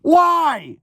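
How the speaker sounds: high squeaky incredulous why; high disbelief. Real, not acted. On hearing cops were called, a shocked squeal. Police called over a chip.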